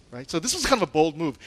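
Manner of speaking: ordinary talk, nothing marked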